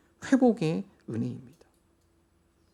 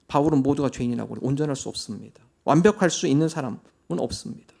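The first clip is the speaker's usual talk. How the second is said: ordinary delivery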